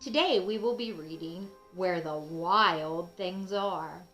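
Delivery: usual speaking voice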